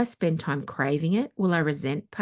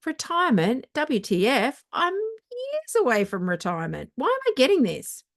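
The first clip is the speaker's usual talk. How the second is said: much higher, incredulous and indignant; shocked outrage at a retirement offer; why am I getting this, high and outraged; indignant disbelief; 8 semitones higher than usual. Real, not acted. Indignant reaction to retirement email.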